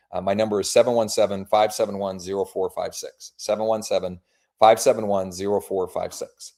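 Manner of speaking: bright, upbeat phone message voice; cheerful realtor on the phone; voicemail pitch. Acted. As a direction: leave a friendly sales voicemail. Leaves a sales voicemail.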